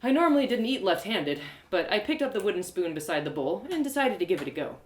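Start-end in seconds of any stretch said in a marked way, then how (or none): none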